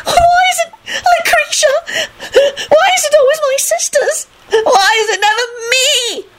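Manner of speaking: very high, melodramatic crying; sobbing shriek. A narrator's reading. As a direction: wail self-pityingly through sobs. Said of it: "Loretta shrieked".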